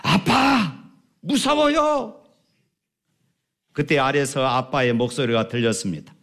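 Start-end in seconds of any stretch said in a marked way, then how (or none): 0.0-2.2 put-on high, slow scared child's cry; a child's frightened plea, voiced higher. A narrator's reading. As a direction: call out like a terrified boy. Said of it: "아이는 무서워서 울부짖습니다".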